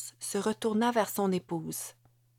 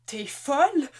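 higher, pained shout; shocked cry; 7 semitones higher, 4 dB louder than usual. A narrator's reading. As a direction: cry out in disbelief, nose bleeding. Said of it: "cria-t-il". (cri choqué, plus aigu)

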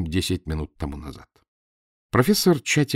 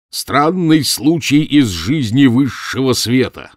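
mock headline; 5 semitones higher, 7 dB louder, slower than usual. A narrator's reading. Professor mocks a headline.

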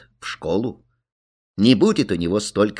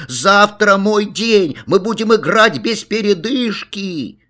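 put-on high, stretched-out childish yell; loud, insistent kid's call; boy shouting; 10 semitones higher, 4 dB louder than usual. A narrator's reading. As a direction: cry it out as if being led away. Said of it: "Он кричал".

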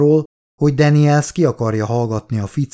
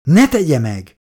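alarmed plea. A narrator's reading. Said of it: "riadtan dörömbölni kezdett".

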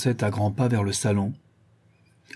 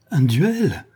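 "Un duel!" exclaimed high in shock; horrified exclamation on "Un duel!"; alarmed cry; 5 semitones higher, 4 dB louder than usual. A narrator's reading. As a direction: cry "Un duel!" with dread, the pitch arching up then falling. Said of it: "s'écria Fedora avec effroi".

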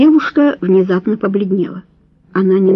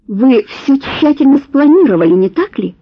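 higher, louder bitter accusation; 5 semitones higher, 3 dB louder than usual. A narrator's reading.

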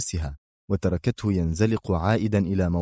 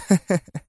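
brief high laugh; short laugh; 10 semitones higher than usual. A narrator's reading. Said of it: "أطلقت هي ضحكة قصيرة".

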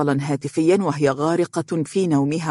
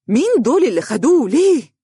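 high, loud, alarmed questions; panicked; 9 semitones higher, 5 dB louder than usual. A narrator's reading. Boss jumps up at news of arrest, panicked. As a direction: cry out in shock. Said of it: "وقالت بفزع".

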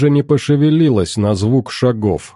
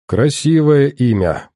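slow, drawn-out compliment; slow, lingering praise for her name; 4 semitones higher than usual. Acted. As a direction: stretch the words out lazily. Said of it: "протянул я".

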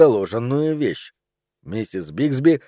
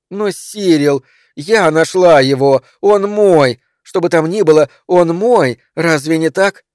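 higher, louder, insistent plea; agitated; 5 semitones higher, 6 dB louder than usual. A narrator's reading. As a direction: press your claim, worked up and loud. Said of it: "Зачем столько волнений".

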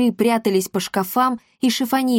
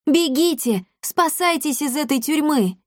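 put-on higher-pitched girl urging people on; cheerful exclamation; 7 semitones higher than usual. A narrator's reading. Girl urging freed prisoners to flee, cheerfully exclaiming. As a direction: call out eagerly, voice lifted. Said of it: "весело воскликнула Дарина".

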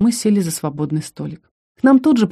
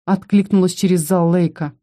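usual speaking voice

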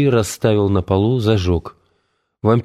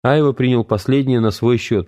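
ordinary delivery, nothing marked

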